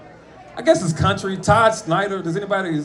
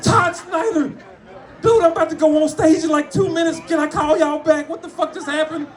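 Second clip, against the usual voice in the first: much higher, breathless fanboy rush; excited and flustered on the phone before a show; starstruck excitement; 11 semitones higher than usual. Retold or imitated. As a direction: gush like you can't believe who's calling.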